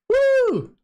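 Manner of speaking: loud, high-pitched "woo"; short celebratory squeal of a woo; high whoop. Real, not acted. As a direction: let out a sudden high whoop. Celebrates chat saying British accent.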